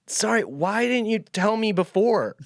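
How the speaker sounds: high, loud, drawn-out shouted question; baffled calling out. Retold or imitated. Traveler to farmer who ignored him, baffled shout.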